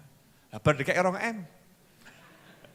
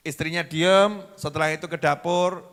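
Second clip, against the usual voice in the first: ordinary talk, nothing marked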